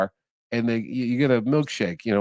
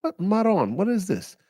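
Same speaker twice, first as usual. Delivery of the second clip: high, exasperated protest; 6 semitones higher than usual. Retold or imitated. To the cop calling his son, high and exasperated. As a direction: throw up the question in disbelief. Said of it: "And I go".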